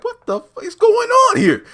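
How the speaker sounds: loud falsetto disbelief; mock outrage. Host reacting to the cult scene, mock outrage. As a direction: shriek it high, hammed-up.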